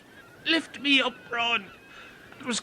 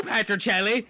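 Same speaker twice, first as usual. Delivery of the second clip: ordinary delivery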